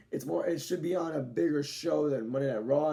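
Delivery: normal talking voice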